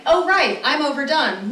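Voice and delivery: high, mock child realising it's done; hammed-up overdone child; kid melting down. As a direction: voice the kid collapsing at pickup. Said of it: "they're like".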